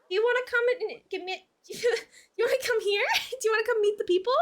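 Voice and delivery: high coaxing voice for a cat; pet talk voice. Real, not acted. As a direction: coax the kitty over, high and sweet. Coaxing cat to come over.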